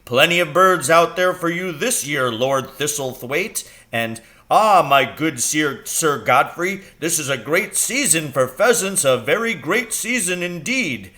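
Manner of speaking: pompous host. Retold or imitated. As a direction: strut it like a proud peacock. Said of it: "saying things like".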